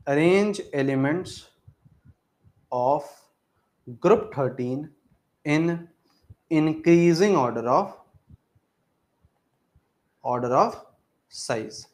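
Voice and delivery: slow, word-by-word dictation. Shown as a demonstration. Teacher dictating a question to students, slow and spaced. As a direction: spell the question out slowly for notes. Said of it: "dictating the question slowly".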